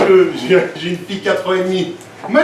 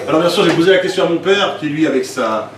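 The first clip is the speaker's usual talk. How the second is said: normal talking voice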